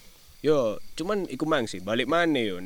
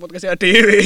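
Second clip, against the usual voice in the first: ordinary delivery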